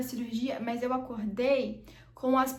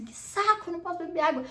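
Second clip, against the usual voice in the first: mock exasperated outburst, pitched higher; ругательное ворчание; 6 semitones higher, faster than usual. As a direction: retell a fed-up complaint in a higher voice. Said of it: "eu só xingava".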